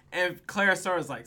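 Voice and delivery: normal talking voice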